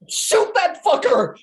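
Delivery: high yelled order; panicked yell. Acted. Steve yells at Dave to shoot the fleeing goon, panicked yell. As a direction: scream it after the runner.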